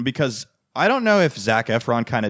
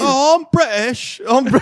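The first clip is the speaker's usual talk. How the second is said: high, slow put-on British accent; mock posh British accent; 13 semitones higher, 6 dB louder than usual. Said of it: "I think he's British".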